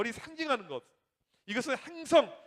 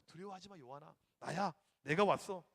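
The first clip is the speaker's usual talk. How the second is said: very soft, lowered comforting voice; tender reassurance; 5 semitones lower, 3 dB quieter than usual. Acted. Jesus visits suffering John in exile, hushed and tender. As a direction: soothe a frightened friend, almost under the breath.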